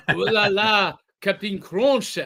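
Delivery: mock French accent; a light French accent on ooh la la. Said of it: "pretty good, [name]".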